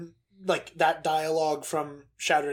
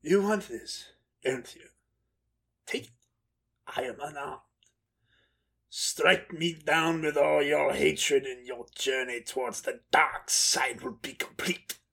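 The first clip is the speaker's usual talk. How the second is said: slow, menacing Sith temptation; dark side voice; 4 semitones lower, 5 dB quieter, slower than usual. Acted. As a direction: draw it out, savoring the evil. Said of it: "That dark side was just too good. It was juicy".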